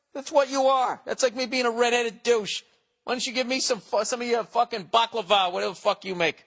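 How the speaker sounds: high-pitched mock-furious tirade; outraged rant. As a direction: let rip like a fed-up man at his door. Rants what he should have said.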